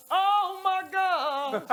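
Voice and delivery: very slow, loud, drawn-out gasp; shocked. Real, not acted. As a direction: stretch it out in disbelief. Shocked at the sink.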